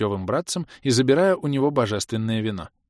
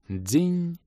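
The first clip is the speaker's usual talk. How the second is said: high imitated clink; 8 semitones higher than usual. A narrator's reading. Bottle breaking imitated.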